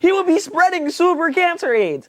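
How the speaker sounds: very high, excited squeal of amusement; laughing disbelief. Real, not acted. Friend joining a joke about a vampire, laughing excitedly. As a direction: crack up at the absurd idea. Friends cracking up at absurd idea.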